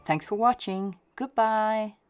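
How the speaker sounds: plain reading voice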